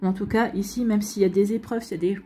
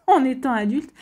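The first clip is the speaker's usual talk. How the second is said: laughing through the words; with a little laugh; 4 semitones higher, 5 dB louder than usual. Real, not acted. Repeats phrase with a laugh.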